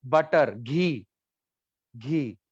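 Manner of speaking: बटर, घी spelled out very slowly; slow teacher gloss. Shown as a demonstration. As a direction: drag out घी for the class. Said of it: "मतलब की".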